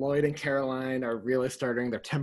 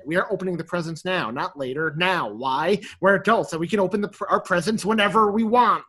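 insistent; 4 semitones higher, 7 dB louder than usual. Retold or imitated. Gus insisting, voiced.

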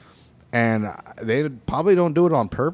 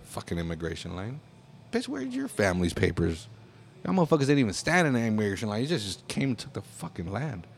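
bitter muttering; 4 semitones lower, 6 dB quieter than usual. Real, not acted.

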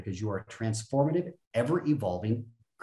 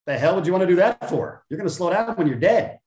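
louder, higher rant at himself; mock rant; 5 semitones higher, 5 dB louder than usual. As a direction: throw the question out, raised and loud. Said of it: "I'm like".

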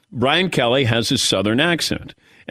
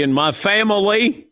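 put-on Southern accent; 8 semitones higher than usual. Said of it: "Every time I hear him say".

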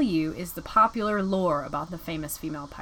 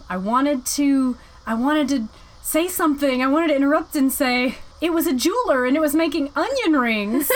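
mocking mom; 6 semitones higher, 5 dB louder than usual. Mom's words acted in a story.

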